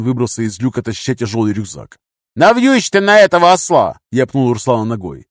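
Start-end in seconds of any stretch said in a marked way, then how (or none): 2.3-4.0 high, loud command; irritated order. A narrator's reading. Leader ordering pack onto captive, loud irritated shout. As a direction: snap the order with force.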